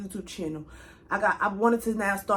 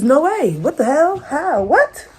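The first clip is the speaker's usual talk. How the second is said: high, loud stunned what; genuinely stunned, loud and high; real shock; 5 semitones higher, 8 dB louder, slower than usual. Real, not acted. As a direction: blurt it out in real disbelief, pitched way up. Shock at pregnancy news.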